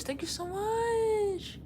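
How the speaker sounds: high, drawn-out squeaky thank-you; gushing thanks. Pretend. Streamer thanking a fan who loves the videos, high gushing squeal. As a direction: squeal it softly, stretching the words.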